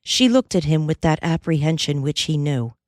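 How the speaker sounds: plain reading voice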